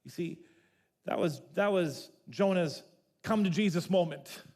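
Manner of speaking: usual speaking voice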